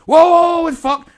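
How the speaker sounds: high, loud startled exclamation; retold shock, shouted high. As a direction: blurt it out in horror. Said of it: "And I went".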